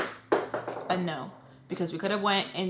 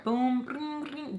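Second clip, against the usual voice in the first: usual speaking voice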